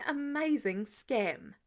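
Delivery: ordinary delivery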